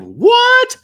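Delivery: loud, very high-pitched mock yell; imitated yell. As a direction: yell it out like a baffled audience. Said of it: "they kinda just yelled".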